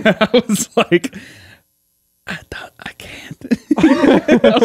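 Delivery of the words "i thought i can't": barely audible, voiceless mock whisper; tiny acted whisper; retold whisper. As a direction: whisper as if dad might wake. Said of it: "whispering 'cause I didn't want".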